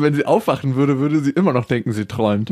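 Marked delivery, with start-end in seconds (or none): none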